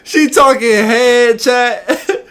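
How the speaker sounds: high, loud, drawn-out hype; hyped disbelief. Real, not acted. Streamer reacting to chat about her text, loud high hype. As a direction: exclaim it high and slow, cracking up.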